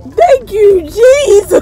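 high, loud tearful thanks; crying with joy. Real, not acted. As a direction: thank through happy tears. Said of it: "it just hit me".

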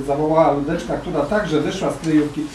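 ordinary delivery, nothing marked

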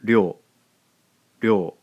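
"ryo" with a tapped r, loud and clear; Japanese ryo. Shown as a demonstration. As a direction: model "ryo" as one syllable. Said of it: "gives us the sound".

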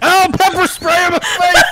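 high cry breaking into laughter; laughing yell. Real, not acted. Player pepper-sprayed in the game, high laughing yell. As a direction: yell it while cracking up.

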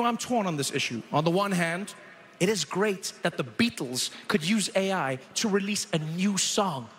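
usual speaking voice

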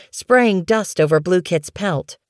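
plain reading voice

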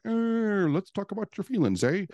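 mock therapist, high Canadian lilt; accidental Canadian accent. Life coach mimicking therapists who sit around, slipping into a Canadian accent. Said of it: "I don't know why that went Canadian".

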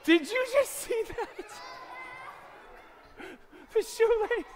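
high-pitched, put-on amazement; high stunned disbelief. Magician asks kids where the shoelace went, high and stunned. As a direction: squeak it in mock bewilderment.

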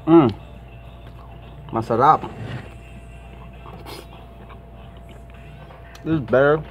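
talking between bites of chicken; muffled words while chewing; mouth full chewing. Real, not acted. Seen 2: Bites chicken at 1.5s, chews while saying "masarap.

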